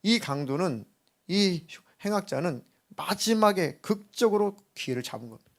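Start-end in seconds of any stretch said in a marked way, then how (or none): none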